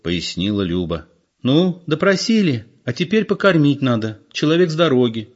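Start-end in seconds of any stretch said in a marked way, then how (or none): 1.4-5.3 put-on young woman's voice. A narrator's reading. Lyuba voicing daughter.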